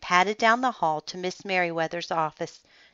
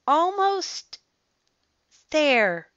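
straining reach; 11 semitones higher, much slower than usual. Acted. Reaching for a high shelf.

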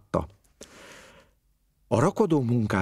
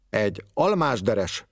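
ordinary delivery

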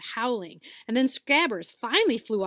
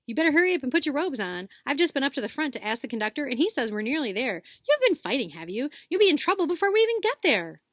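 higher, louder, fast know-it-all; bossy girl; 6 semitones higher, 6 dB louder, faster than usual. Acted. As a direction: scold them briskly. Hermione lecturing the boys.

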